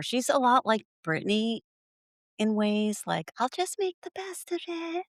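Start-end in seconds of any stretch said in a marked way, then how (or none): 3.4-5.1 high, soft fake-cheerful imitation; forced smile. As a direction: pretend brave face through a smile. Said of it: "Like, with the smile".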